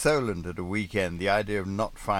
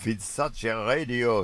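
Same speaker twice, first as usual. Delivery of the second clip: growled; 5 semitones higher, 4 dB louder than usual. Acted. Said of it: "he growled".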